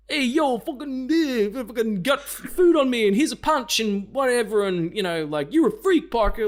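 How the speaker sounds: hammed-up bully caricature, high and loud; Joe Manganiello. Caricature of the bully picking on Parker, loud mock taunting. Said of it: "the caricature of, um, Joe Manganiello being like".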